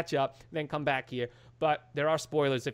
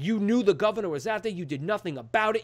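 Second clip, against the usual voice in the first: raised, higher-pitched imitation of angry accusations; laying into him; 5 semitones higher, 4 dB louder than usual. Retold son blaming his father, heated scolding. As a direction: act out a son's tirade. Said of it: "he just laces into him".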